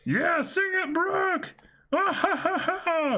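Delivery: very high rowdy cheer and laugh. Acted. Reading crew cheering Brooke, high rowdy whoop.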